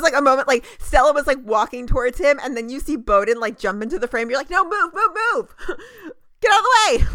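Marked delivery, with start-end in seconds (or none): 4.5-7.1 higher, louder, urgent shooing; mock frantic shout. Fan shooing the chief out of the hug shot, mock urgency. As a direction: shoo him out of the frame, half laughing. Fan yelling at Bowden onscreen.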